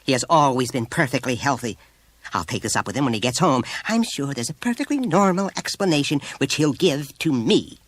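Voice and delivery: indignant. A narrator's reading. Mother ending the call on the teacher, indignant. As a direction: cut in and defend your son huffily.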